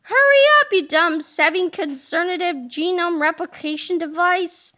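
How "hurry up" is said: high, loud yell; raised, pressing command; urgent shout. Acted. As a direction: shout it impatiently. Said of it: "shouted Melvin".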